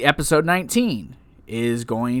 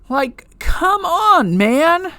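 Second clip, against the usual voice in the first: whiny; 12 semitones higher, much slower than usual. Real, not acted. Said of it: "I sound like a whiny person".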